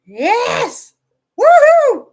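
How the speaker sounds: loud, high-pitched whoop; real burst of celebration; excited cheer. Real, not acted. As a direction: shout it in triumph, then whoop. Cheering at something during recording.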